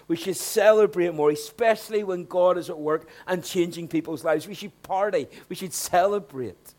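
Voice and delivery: fervent call to celebrate; full-force preaching. Acted. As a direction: preach it out with conviction. Sermon climax rising intensity.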